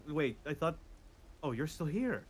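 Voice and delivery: ordinary delivery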